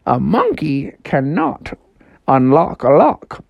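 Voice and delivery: slow, swooping game-master voice; talking house. Acted. House announces the final riddle to Froggy, slow and grand. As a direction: announce it grandly, drawing out the words. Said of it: "the house was like".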